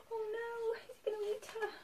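high, soft worried gasp; mock dread. Reader fearing dragon will eat witch, mock dread. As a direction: whimper it softly in pretend fright.

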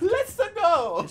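high gleeful shout; excited cheer. Real, not acted. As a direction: cheer right back. Echoing the hype cheer.

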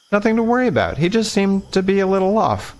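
put-on high, light girlish voice, reassuring; Lena's voice. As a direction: lift the voice up into a girl's tone, soothing.

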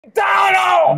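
indignant yell of protest; protesting shout. Real, not acted. Son refusing dad's restaurant choice, protesting shout. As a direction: cry out in objection.

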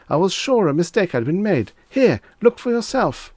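high, loud, urgent pleading; frantic shrieking. A narrator's reading. Reggie holding out the book to Mister Demise, frantic and high. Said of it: "he shrieked".

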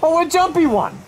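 high-pitched indignant threats; worked-up taunting. Real, not acted. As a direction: rant at the enemy in a high, heated voice. Taunts and threatens the game boss.

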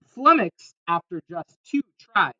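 usual speaking voice

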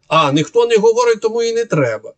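high sarcastic parody of the critics' logic; a high, sneering take on the critics' argument; mocking quote. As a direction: pitch it up high to ridicule their argument.

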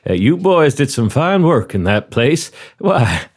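put-on courteous Southern-accented interviewer; genial southern commander. Commander offers the applicant a seat, genial southern role. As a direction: play a hospitable commander running an interview. Commander praises factory work.

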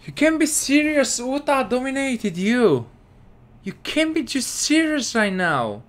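can't believe what the players are doing; exasperated. Real, not acted. Ranting at his players.

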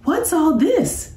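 high, startled question; surprised professor. A narrator's reading. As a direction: react to a sudden scene. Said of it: "said the professor".

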